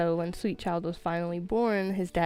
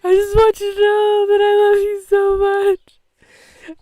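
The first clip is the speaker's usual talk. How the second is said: high, loud tearful wail of love; crying; 12 semitones higher, 11 dB louder, slower than usual. Retold or imitated. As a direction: sob it out like a final goodbye. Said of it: "I was, like, crying".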